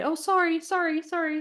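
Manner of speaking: high, quick string of sorries; over-apologetic sorry. Retold or imitated. Mocking how we apologize to everyone. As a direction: imitate reflexive apologizing. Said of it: "we literally say".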